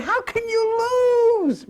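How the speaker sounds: high, slow, drawn-out preacher line; a hint of a flamboyant evangelist; mock preacher catchphrase. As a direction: stretch it out like a showman preacher.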